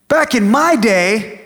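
ranting dad, high-pitched and indignant; acted outraged boasting at his son; going off on someone. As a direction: launch into a hammed-up angry tirade, voice way up. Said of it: "I just started going off on him".

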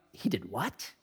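hushed, low disbelief; softly acted incredulity; shocked reader. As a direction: react in a stunned undertone.

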